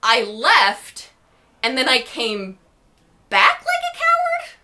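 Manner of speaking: mock-incredulous echo rising to a squeak. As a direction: voice shooting way up on coward in disbelief. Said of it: "And I was like".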